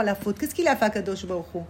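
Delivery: ordinary talk, nothing marked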